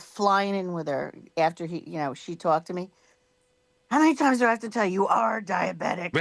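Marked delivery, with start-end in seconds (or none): 3.9-6.2 fast, raised mock-exasperated telling-off; imitated scolding. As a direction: scold like a fed-up doctor, hammed up. Retells doctor's exasperated scolding.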